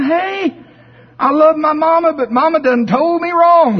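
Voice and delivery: high, loud chicken character bobbing on water. Acted. Chicken Chuck calls out happily on the water, high voice. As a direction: pitch him way up as the naive chicken.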